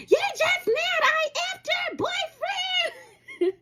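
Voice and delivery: high-pitched mocking screech, stretched out; mock screaming. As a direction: imitate her screaming it like a boast. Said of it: "Screaming, as if this was an accomplishment".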